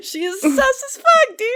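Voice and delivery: very high, loud, laughing squeal; laughing agreement. Real, not acted. Storyteller agreeing with co-host about the suspect, laughing squeal.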